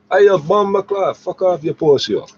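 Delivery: very high, loud, slow put-on Jamaican patois; mock Jamaican patois. Retelling the closest thing to a threat, in a loud mock Jamaican accent. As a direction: bark it out in a hammed-up Jamaican accent. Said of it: "This is as close as it got to a threat".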